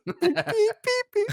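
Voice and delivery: very high, piping imitation of beeps; high mouth-made electronic beeps; microwave beeps. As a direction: imitate microwave buttons in a squeaky pitch.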